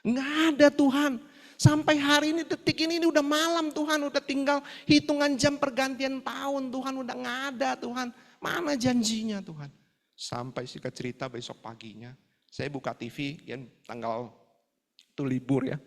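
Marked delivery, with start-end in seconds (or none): 0.1-9.7 high, loud plaintive cry to God; acted-out anguished lament; tearful pleading. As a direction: plead desperately, retelling a tearful prayer. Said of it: "Saya nangis-nangis sama Tuhan".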